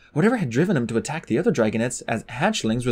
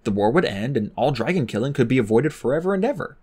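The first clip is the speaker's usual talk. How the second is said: ordinary delivery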